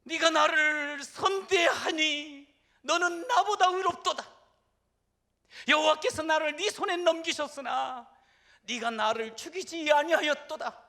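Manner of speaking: preacher voicing Saul's loud weeping cry; Saul crying aloud. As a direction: wail it like a king weeping aloud. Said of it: "소리를 높여서 웁니다".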